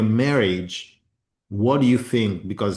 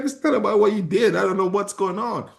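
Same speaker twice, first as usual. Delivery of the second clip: higher, lightly voiced upset wife; wife's tearful reply; 11 semitones higher than usual. Husband voicing his wife's tearful explanation, higher. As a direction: imitate her, helpless and a little teary. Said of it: "she's like".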